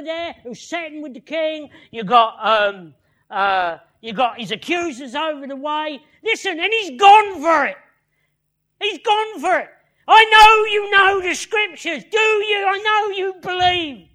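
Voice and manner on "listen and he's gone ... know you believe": loud, high-pitched preacher in full flow; full-force sermon delivery; impassioned preaching. Acted. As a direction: preach it hard, voice raised and pushing.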